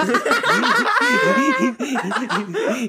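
shrieking laughter; high-pitched shriek of laughter; loud high laugh burst. Real, not acted. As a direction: explode into a high cackle.

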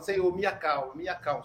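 calm prayer. Shown as a demonstration. Host shows listeners how to ask God calmly, soft and settling. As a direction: pray it quietly, calming yourself down. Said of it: "agitado, fala".

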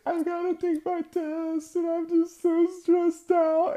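very high, hammed-up wail; mock crying. Sobbing pupil answers his teacher, exaggerated crying. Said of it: "and, like, just crying".

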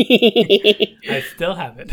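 slow, loud, through laughter; laughing. Real, not acted. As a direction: laugh the words out. Laughing at the lisp comment.

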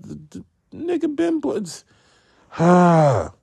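squealing high with excitement; excited. Real, not acted. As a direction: voice jumps way up in glee. Excited burst about the actor.

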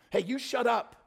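irritated call for silence; telling off. Acted. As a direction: turn and order him to shut up. Crowd blocking and silencing beggar.

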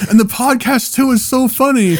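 high, enthusiastic fan imitation; excited fan. Fursuiter praising the podcast, imitated excitement. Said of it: "He's like".

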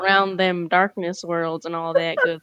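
playful cowboy-style Southern twang; hammed-up Southern accent. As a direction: ham it up like a Southern cartoon pony. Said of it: "in My Little Pony Southern accents".

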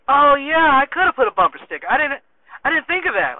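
friend's sheepish realization. Retold or imitated. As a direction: play the friend realizing his goof. Friend voiced in story.

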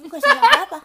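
high, loud, laughing complaint; rising into a real laugh; laughing protest. Real, not acted. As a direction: protest innocence while cracking up. Joking complaint, breaking into laughter.

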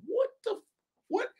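high, breathless double take; stunned disbelief. Retold or imitated. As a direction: react as if you can't believe your ears. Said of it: "I'm like".

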